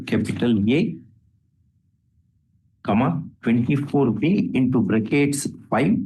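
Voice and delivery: dictating. Real, not acted. Judge dictates order with punctuation.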